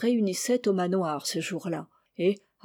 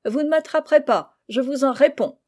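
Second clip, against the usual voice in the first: higher, louder dare; cocky defiance in a raised voice; defiant; 5 semitones higher, 4 dB louder than usual. A narrator's reading. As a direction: throw down a challenge. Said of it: "Rose dit d'un air de défi".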